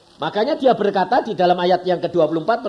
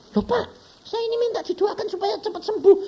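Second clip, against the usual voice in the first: whiny protest; faster than usual. Retold or imitated. Mimicking a sick congregant's protest.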